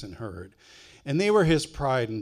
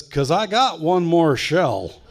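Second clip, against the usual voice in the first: slow and louder, dry delivery; slow deadpan punchline; 4 semitones higher, 8 dB louder, slower than usual. Retold or imitated. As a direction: lay the punchline down slowly. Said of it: "And my brother says".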